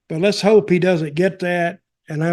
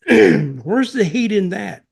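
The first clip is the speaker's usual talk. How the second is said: ordinary talk, nothing marked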